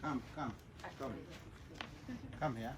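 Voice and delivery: usual speaking voice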